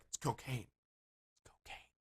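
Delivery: hushed, fading into a drawn-out whisper; furtive whisper. Retold or imitated. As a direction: whisper it like a secret offer. Acting out furtive drug offer in bathroom.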